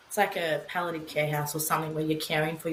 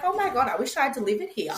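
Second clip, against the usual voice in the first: high, fast gush of delight; thrilled by a lovely room; retold delighted gush; excited; 6 semitones higher, much faster than usual. Amazed by the rooms.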